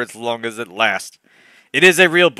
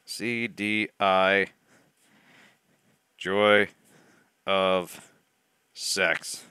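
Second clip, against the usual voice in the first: muttering while writing; 5 semitones lower, 6 dB quieter, much slower than usual. Real, not acted. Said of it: "I'm gonna write this down".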